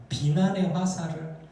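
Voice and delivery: ordinary delivery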